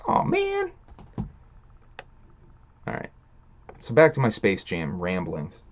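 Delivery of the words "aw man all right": mock whine. Real, not acted. Mock disappointment.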